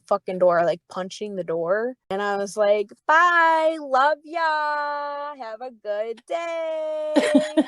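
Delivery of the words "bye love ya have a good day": very high, stretched-out singsong; taunting goodbye. Retold or imitated. Fired server to raging boss behind the door, taunting sweet goodbye. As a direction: call it out mock-lovingly, dragging the words.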